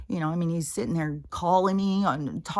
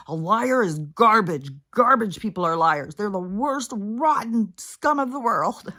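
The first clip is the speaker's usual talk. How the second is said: mother's harsh lesson; 3 semitones higher, 6 dB louder than usual. Retold or imitated. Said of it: "'cause my mom".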